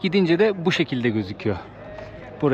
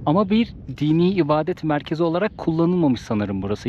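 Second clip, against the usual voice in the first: ordinary talk, nothing marked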